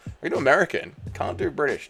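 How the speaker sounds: ordinary talk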